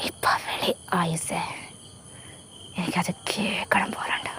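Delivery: soft, slow, breathy whisper close to her ear; intimate whisper. A narrator's reading. Groom pulling Ayis close, begging her: intimate whisper. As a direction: whisper it as if only she may hear.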